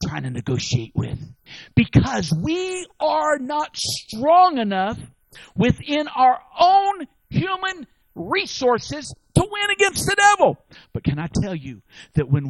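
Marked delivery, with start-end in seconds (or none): 1.7-10.6 full-force preacher build; preaching. Acted. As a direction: drive each word home from the pulpit. Preaching climax.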